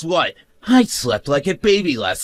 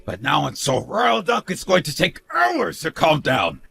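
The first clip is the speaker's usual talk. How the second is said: ordinary talk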